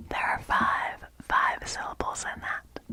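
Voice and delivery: hushed, fully whispered ASMR answer. Acted.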